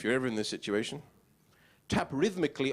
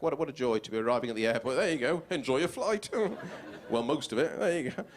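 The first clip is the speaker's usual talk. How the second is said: ordinary talk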